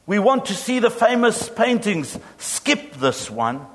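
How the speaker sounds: shouted demand. A narrator's reading. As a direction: call it out over the crowd. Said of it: "a voice in the back of the room shouted".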